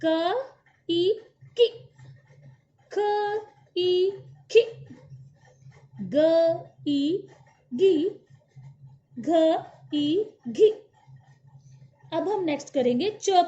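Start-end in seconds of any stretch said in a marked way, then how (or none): 0.1-10.7 put-on a teacher chanting the blend for children to repeat, slowing right down on the last one; louder, drawn-out sounding-out drill; syllable drill. Shown as a demonstration. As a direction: sound out each letter, then the vowel, then the joined syllable. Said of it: "हम एक बार इस line का उच्चारण करते हैं".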